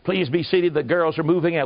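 creaky emphysema voice over an intercom; cracky voice. Retold or imitated. Mother Superior welcomes visitors over the speaker, cracky voice. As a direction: croak out a formal welcome like an ailing woman. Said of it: "this cracky voice came on the speaker and said".

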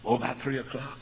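usual speaking voice

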